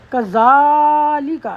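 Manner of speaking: کذالک said slowly with the "za" stretched long; drawn-out za. Shown as a demonstration. Teacher showing the standing zabar, long za. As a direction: hold the "zaa" in کذالک over three counts. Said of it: "زا کو فتح".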